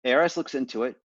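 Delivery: normal talking voice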